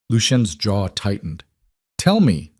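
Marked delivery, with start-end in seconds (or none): 2.0-2.5 firm demand. A narrator's reading. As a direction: insist he tell her. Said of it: "Lena demanded".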